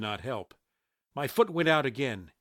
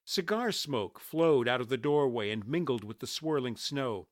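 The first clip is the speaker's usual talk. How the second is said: plain reading voice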